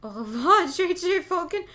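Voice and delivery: gloating farewell. Acted. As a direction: laugh as you say goodbye to your victim. Villain bids farewell after attack.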